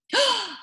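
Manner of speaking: sharp high gasp of surprise. Shown as a demonstration. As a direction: show the class what a gasp sounds like. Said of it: "You know what gasp means?".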